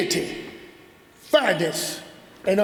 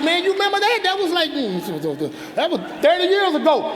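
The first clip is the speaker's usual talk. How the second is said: amused surprise; 7 semitones higher, faster than usual. Retold or imitated. Surprised at being remembered.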